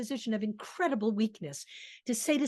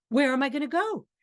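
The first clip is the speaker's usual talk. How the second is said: helpless pleading; 4 semitones higher, 6 dB louder, faster than usual. Acted. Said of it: "just him rabbiting on".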